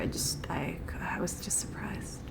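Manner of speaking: very soft, whispered excuse; quiet, faltering backpedal; sheepish near-whisper. Pretend. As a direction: let the apology sink into a whisper. Sheepish apology, voice sinks away.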